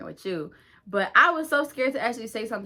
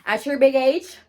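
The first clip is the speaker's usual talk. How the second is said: louder, higher mocking jab on big age; sassy jab; 6 semitones higher, 11 dB louder than usual. Teen cussing out older girl, loud sassy jab. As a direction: throw it in her face.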